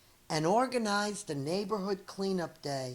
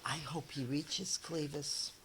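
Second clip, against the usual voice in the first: hushed hope; 3 semitones lower, 16 dB quieter than usual. Acted. Onlookers murmuring hopefully.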